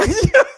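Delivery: short high burst of laughter. Real, not acted. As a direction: laugh out loud at herself. Laugh after cake line.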